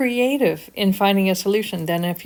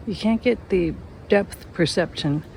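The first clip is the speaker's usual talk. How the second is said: usual speaking voice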